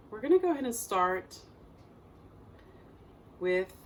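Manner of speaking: calm meditation guide with long, unhurried pauses; guided meditation. Acted. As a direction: guide listeners gently through breath and body, leaving space between phrases.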